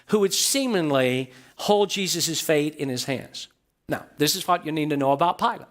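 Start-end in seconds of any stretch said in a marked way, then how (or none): 0.1-3.5 seemingly lengthened and leaned on; stress on seemingly. Shown as a demonstration. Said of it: "I emphasize the word seemingly".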